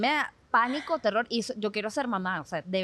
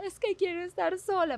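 put-on high, whiny little-girl plea; imitating crying goddaughter; 9 semitones higher than usual. Telling friends how her goddaughter cried, in a high childish whine. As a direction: mimic a sleepy three-year-old sobbing her words. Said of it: "llorando y diciendo".

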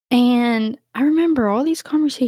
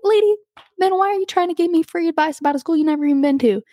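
high-pitched, rushed mock indignation; pitched-up rapid protest to a meddling stranger; exasperated retort; 9 semitones higher, faster than usual. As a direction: re-enact an incredulous comeback, fast and high. Acted retort to nosy shop lady.

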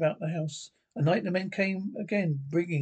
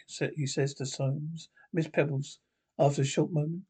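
ordinary delivery

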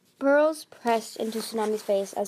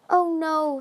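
high, loud little squeak; 7 semitones higher, 6 dB louder than usual. A narrator's reading. Sunny hearing of a threatened death, squeaking. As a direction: squeak in alarm. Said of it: "Sunny squeaked".

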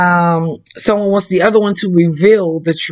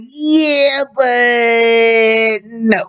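mock reluctant refusal; much slower than usual. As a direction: play stretching out an awkward let-down. Acts reluctant partner's refusal.

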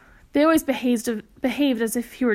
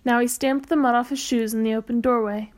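ordinary delivery

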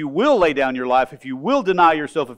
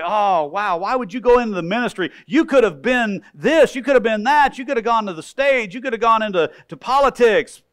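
mocking skeptic; 6 semitones higher, 3 dB louder than usual. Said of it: "somebody will say".

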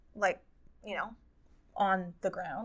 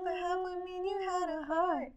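high, put-on dramatic delivery of the line; lightly sung-sounding lyric quote; quoting a dramatic line; 9 semitones higher, 6 dB quieter than usual. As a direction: recite it like a moody pop lyric. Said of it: "What's the line?".

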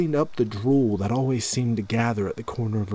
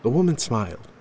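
plain reading voice

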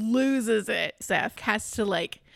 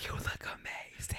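breathless whisper of admiration; hushed, dazzled compliment; awed whisper; 13 dB quieter than usual. Acted. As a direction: whisper it, starstruck. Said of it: "He sees how she's dressed".

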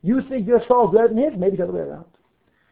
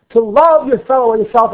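"love" lifted and pressed louder; stress on LOVE; 3 semitones higher, 7 dB louder than usual. Shown as a demonstration. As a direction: lean hard on the word "love". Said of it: "with the emphasis on the word love".

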